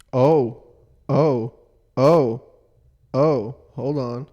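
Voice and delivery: loud repeated excited oh; excited exclamation. Real, not acted. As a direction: call out, excited discovery. Excited while searching.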